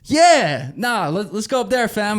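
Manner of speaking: high, loud bro talk; hyped-up buddy talk. Retold or imitated. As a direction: pump up your mate. Acting out chat with the driver.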